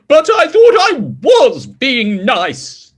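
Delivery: protest, lightly voiced as the pig king. King Pig answering the little voice, lightly hurt protest. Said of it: "said King Pig".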